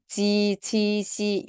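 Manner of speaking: 지, 치, 시 held level with "ee"; i version. Shown as a demonstration. Teacher recaps the i sounds, held "ee" in 지, 치, 시. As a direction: give 지, 치, 시 a clear 이 vowel. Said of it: "요게는 이 버전".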